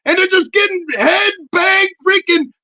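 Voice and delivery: high, loud, screechy scolding; mock yell. Yelling at the hairy-legged woman in the airport, high and loud. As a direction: holler at her like birds are dying. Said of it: "I was like".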